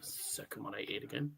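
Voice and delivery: whispered aside; whispering. Real, not acted. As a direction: breathe it out with no voice. Whispered aside during match.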